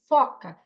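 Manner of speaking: "foca" with open O, firmly; clear, emphatic "foca" with open first vowel; corrective repeat. Shown as a demonstration. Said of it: "aí eu repito".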